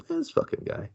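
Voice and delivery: whispered mock grumble; muttered aside. Everybody reacting to the greedy host, whispered grumble. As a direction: imitate kids grumbling under their breath. Kids grumble behind his back.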